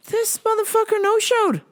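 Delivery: high-pitched, exasperated complaint; indignant. Real, not acted. Host calling out a no-show friend, high indignation. Ranting about friend who stood them up.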